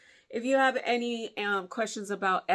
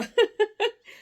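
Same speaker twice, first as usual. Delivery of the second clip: real laugh; 9 dB louder than usual. Real, not acted. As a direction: burst of high, loud laughter. Laughs at her own remark.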